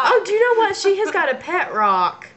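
high and eager; excited. Real, not acted. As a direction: burst out with a sudden idea. Sudden idea.

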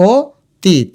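অতীত slowly as o, teet; split in syllables. Shown as a demonstration. Teacher sounding out the spelling, অতীত slowly split o-teet. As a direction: pause between o and teet in অতীত. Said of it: "আমরা অতীত বলি".

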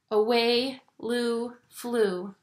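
plain reading voice

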